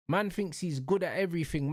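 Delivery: ordinary talk, nothing marked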